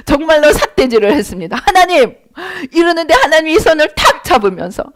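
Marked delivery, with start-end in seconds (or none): 1.7-2.1 higher, indignant call to God; angry cry. Retold or imitated. Preacher retelling her protest, an angry call. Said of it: "분노가 나가지고 하나님께 막 되들었어요".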